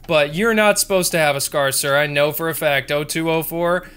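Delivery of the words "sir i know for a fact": ordinary delivery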